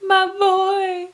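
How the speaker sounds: high, loud acted wail; hammed-up grieving cry; mock anguished father. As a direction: cry it out like a distraught dad, hammed up. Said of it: "Cedric's dad runs down".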